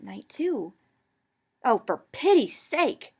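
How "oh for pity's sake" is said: higher, louder, slow exasperated cry; frustrated cry. A narrator's reading. Girl crying out louder at her stubborn friends. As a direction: fling it out, fed up. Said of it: "I cried, running extremely frustrated hands".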